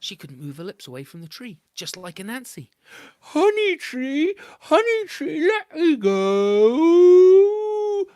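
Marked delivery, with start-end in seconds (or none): 3.2-8.1 high, loud, slow wailing cry; desperate plea. A narrator's reading. Stuck cow crying out to the honey tree, high wailing plea. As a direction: beg the tree to let go, crying.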